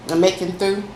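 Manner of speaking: normal talking voice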